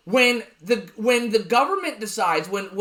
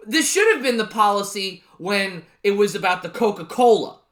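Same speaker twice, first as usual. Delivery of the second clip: normal talking voice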